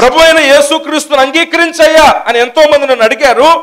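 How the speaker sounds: loud, high-pitched sermon delivery; full-force preaching. Real, not acted. Interpreter voices a preacher's testimony, preaching at full force. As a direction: belt out the confession to the congregation.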